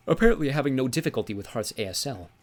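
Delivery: ordinary delivery, nothing marked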